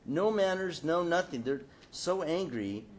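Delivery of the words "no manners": ordinary talk, nothing marked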